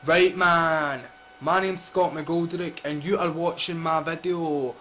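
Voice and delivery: loud exaggerated Scottish drawl; mock tough Scottish intro; put-on rough Scottish. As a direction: ham up a thick Scottish accent. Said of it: "No, I don't talk like that".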